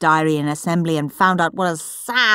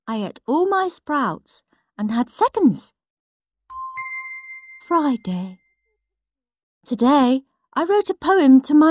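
prim little brother; 6 semitones higher than usual. Acted. Peter's diary entries read out as a prim, smug little boy. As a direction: read it like a proud little swot.

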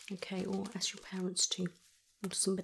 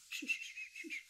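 soothing shush; 8 dB quieter, faster than usual. Acted. Calming a hurt patient.

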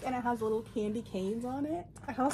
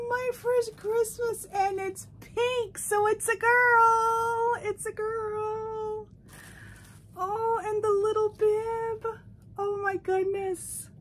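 delighted gasp; 7 semitones higher, 4 dB louder, slower than usual. Real, not acted. Unboxing a pink gift, slow high delight. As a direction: melt over the pink gift.

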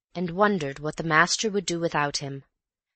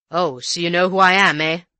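louder gruff colonial voice; rough colonial; 8 dB louder than usual. Acted. Rough visitor challenging the maid, loud and gruff.

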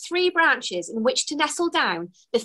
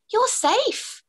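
much higher, gentle reassurance; reassuring; 12 semitones higher than usual. A narrator's reading. Elf calming the frightened fairy, reassuring. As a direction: soothe her, light and high. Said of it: "reassured the elf".

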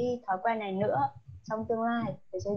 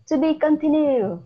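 emphatic; 5 semitones higher, 7 dB louder than usual. Shown as a demonstration. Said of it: "Continue nghĩa là gì nhỉ".

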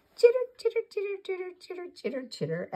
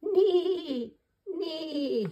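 high whinnying neighs; pony neigh; 10 semitones higher than usual. Shown as a demonstration. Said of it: "Ponies neigh".

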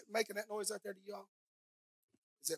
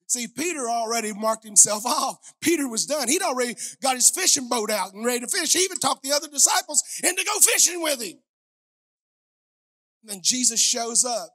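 louder, higher fervent sermon delivery; full-force preaching; 5 semitones higher, 11 dB louder than usual. Acted. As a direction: preach it out to the congregation.

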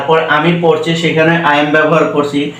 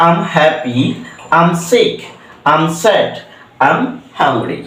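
slow model phrase; much slower than usual. Shown as a demonstration. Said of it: "am উচ্চারণ করে".

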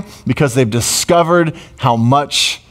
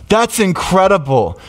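normal talking voice

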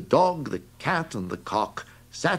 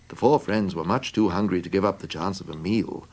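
plain reading voice

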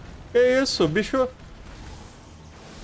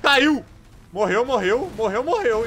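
high, loud startled cry; excited yelp; 9 semitones higher, 4 dB louder, slower than usual. Real, not acted. Gamer reacting to getting kicked, high excited yelps. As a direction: shout as the character goes down.

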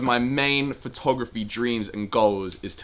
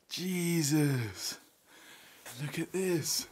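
awed hush; 3 semitones higher, 10 dB quieter, much slower than usual. Real, not acted. Awed by the igloo.

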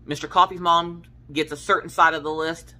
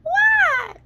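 much higher, a little louder, falling; teasing husband with a high innocent what; mock-innocent high what; 5 dB louder than usual. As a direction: play innocent with a high squeak. Teasing innocent reply again.

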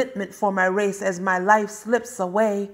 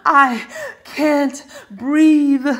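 slow, higher, much louder strained cry; anguished cry; 5 semitones higher, 7 dB louder, much slower than usual. Acted. Poet crying a dying man's last words, anguished and slow. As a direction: wring it out at the peak of the refrain. Refrain builds to anguished climax.

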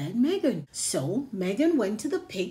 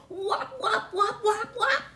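whop sounds; 11 semitones higher than usual. Acted. Pigs trample Megan.

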